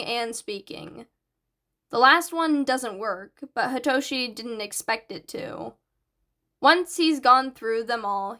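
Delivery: ordinary delivery, nothing marked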